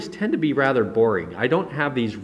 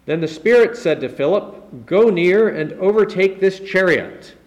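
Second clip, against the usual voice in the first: raised, forceful reading of the verse; scripture reading; 7 semitones higher, 5 dB louder than usual. Acted. Preacher reading the verse to the church, raised and forceful. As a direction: proclaim the verse to the congregation.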